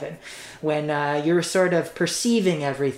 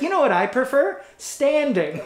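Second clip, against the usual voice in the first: mock tough guy; 9 semitones higher than usual. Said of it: "anybody who's intimidating that's like".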